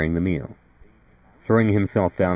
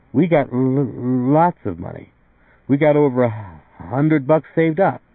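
slow, higher-pitched husband catching on a word; stammering husband; 7 semitones higher, 4 dB louder, slower than usual. A narrator's reading. Art insisting they have savings, slow and higher-pitched. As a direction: drag it out as a stammering young husband.

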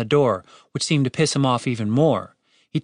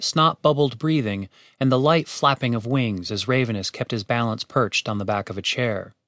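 plain reading voice

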